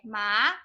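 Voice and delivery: MA lifted at the end like a question; second tone. Shown as a demonstration. Teacher models second tone for students, rising. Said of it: "This is the second tone".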